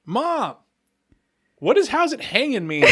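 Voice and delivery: put-on high, boyish call to mother; innocent kid asking mom. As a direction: imitate a clueless child calling across the house. Innocent child calls out to mother.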